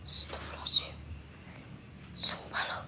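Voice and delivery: breathless whispered prayer, barely audible; hushed frightened whisper; scared whisper. Real, not acted. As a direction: whisper so nothing hears you. Scared after seeing something.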